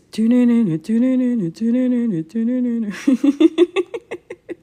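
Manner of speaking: laughing hum of a TV theme; hummed theme through laughter. Retold or imitated. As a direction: hum the eerie theme while giggling. Hums Walking Dead theme.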